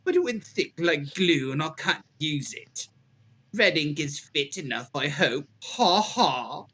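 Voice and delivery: reading a killer's letter in a hammed-up British accent; jolly British accent. Reading the Ripper letter to friends, in a hammed-up British accent. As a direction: lay on a jolly British accent, pausing as you go. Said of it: "when you were doing the accent".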